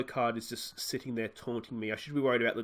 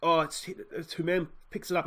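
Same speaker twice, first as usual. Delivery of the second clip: ordinary talk, nothing marked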